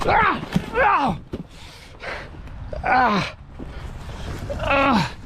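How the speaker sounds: sharp high panicked screams; terrified scream. Real, not acted. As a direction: scream in terror. Attacked near tent, screaming.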